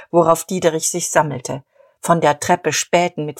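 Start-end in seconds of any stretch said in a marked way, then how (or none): none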